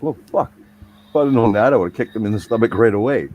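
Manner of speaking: loud, high-pitched acted exasperation; worked-up mock protest; exasperated retort. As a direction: retell the outburst loud and high. Acted exasperated reply to boss's trick.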